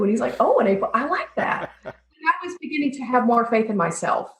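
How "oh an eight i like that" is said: lightly impressed, lifting higher at the end; pleased approval. Retold or imitated. Boss hears her improved self-score, pleasantly impressed. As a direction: retell a mentor's pleased little surprise.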